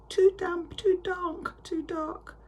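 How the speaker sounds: tiny high squeaking mouse voice; squeaky mouse. Acted. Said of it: "Mouse squeaks".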